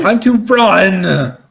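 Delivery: slow, high echo of a battle cry; drawn-out rallying cry. Pretend. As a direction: stretch the call out long and high. Echoes the escape cry.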